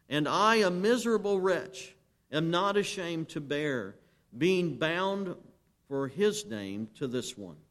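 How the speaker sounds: full-force preaching. Acted. Preacher reading Huss's vow to the congregation, rising full-force preaching. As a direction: build the martyr's words to a ringing climax.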